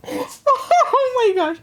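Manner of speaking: shrieking laugh into slow oh my gosh; cracking up at the offended dog. Real, not acted. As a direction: laugh hard and drag it out. Laughing at dog's reaction.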